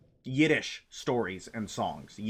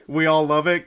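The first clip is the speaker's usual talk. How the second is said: ordinary talk, nothing marked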